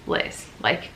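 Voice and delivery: whispered self-talk. Retold or imitated. Talking herself down, self-talk.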